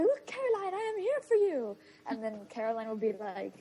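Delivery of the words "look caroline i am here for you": high, earnest mock-dad voice; pretend dad. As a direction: play the doting dad, pitched way up. Imagined dad reassuring Caroline.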